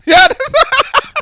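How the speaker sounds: high, loud laughing through words. Real, not acted. Laughing hard.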